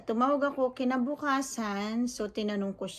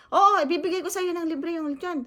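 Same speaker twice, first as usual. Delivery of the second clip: higher, louder confident promise; imitating the owner; 7 semitones higher, 4 dB louder than usual. Vlogger mimics owner confirming the free lechon, louder. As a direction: play the owner swearing he'll pay up. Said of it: "Sabi niya".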